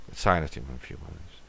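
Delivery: usual speaking voice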